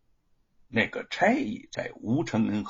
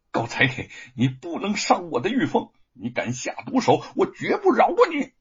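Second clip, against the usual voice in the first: angry threat climbing to a high, loud cry; desperate struggling protest; 7 semitones higher, 4 dB louder than usual. A narrator's reading. Bound husband protecting Yufeng, desperate angry protest. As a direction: warn him off while straining. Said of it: "用力挣扎着".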